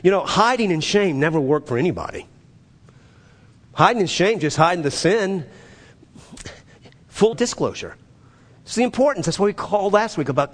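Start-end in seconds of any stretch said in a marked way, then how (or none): none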